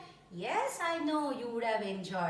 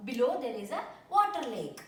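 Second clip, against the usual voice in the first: ordinary delivery, nothing marked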